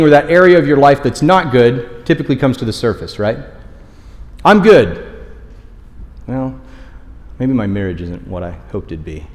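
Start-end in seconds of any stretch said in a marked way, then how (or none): 4.4-5.0 higher, louder, put-on all's well; breezy "fine" reply. Churchgoer answering the water-cooler greeting, breezily covering up. Automatic cheerful reply masking trouble. 6.3-9.3 low, soft private doubt; subdued inner admission. Acted. Churchgoer privately admitting marriage trouble, subdued.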